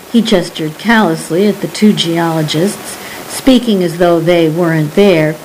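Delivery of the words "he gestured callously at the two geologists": usual speaking voice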